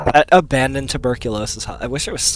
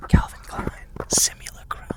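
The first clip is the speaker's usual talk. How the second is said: sultry whisper; 4 dB quieter than usual. Pretend. Mock sexy lingerie ad voice.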